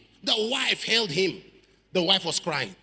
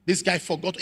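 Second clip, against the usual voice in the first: ordinary talk, nothing marked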